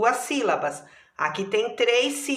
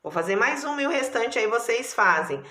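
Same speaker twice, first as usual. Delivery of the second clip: usual speaking voice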